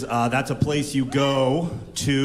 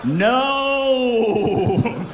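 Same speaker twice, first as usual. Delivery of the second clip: high shouted no; sudden shout; 8 semitones higher, 3 dB louder than usual. Real, not acted. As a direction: bark the reversal.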